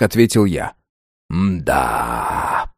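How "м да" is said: long, low drawn-out hum. A narrator's reading. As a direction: drag the sound out. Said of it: "протянул гном". (протяжно)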